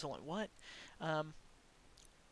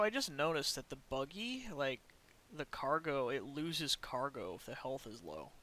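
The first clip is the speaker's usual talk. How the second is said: usual speaking voice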